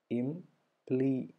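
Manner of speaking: ഇംപ്ലി split as im... plee; syllable by syllable. Shown as a demonstration. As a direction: slowly separate the two beats of "ഇംപ്ലി". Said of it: "ഇംബ് വരെ ഒരെണ്ണം".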